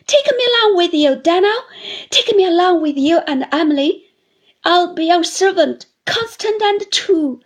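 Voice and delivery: put-on high, loud, crying old widow begging; tearful pleading. A narrator's reading. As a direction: offer herself as his servant through tears. Mrs Gummidge begs to come along.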